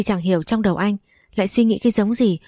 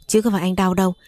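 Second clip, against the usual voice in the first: ordinary delivery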